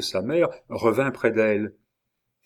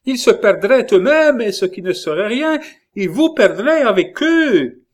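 very high, loud warning; impassioned outcry; 10 semitones higher, 7 dB louder than usual. A narrator's reading. Catherine warning the king of ruin, carried-away cry. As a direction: drive the warning home at full voice. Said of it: "emportée par le sérieux de la situation".

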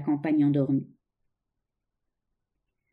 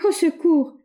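high, loud cry for help; a young woman crying out in fear; terrified cry; 9 semitones higher, 4 dB louder, slower than usual. A narrator's reading. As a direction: scream for help in panic. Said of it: "s'écria André".

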